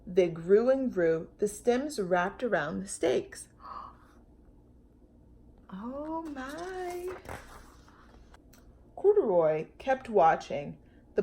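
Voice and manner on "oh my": soft high amazed hush; hushed wonder. A narrator's reading. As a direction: breathe it in awe. Amazed at growing plants.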